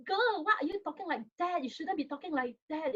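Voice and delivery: high, scolding parent voice, pitched well up; rebuking parent. Shown as a demonstration. Parent rebuking a daughter who fears failing exams, high scolding tone. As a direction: snap back at the girl like a disapproving parent. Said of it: "one way I can respond with".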